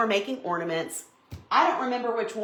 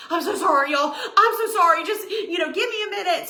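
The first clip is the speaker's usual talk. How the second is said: higher-pitched, flustered mock apology; apologizing; 8 semitones higher, 3 dB louder than usual. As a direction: re-enact a shaken apology to viewers. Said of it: "I kept apologizing".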